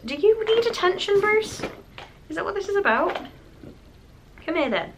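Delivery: pet voice. Real, not acted. Talking to her pet Bruce.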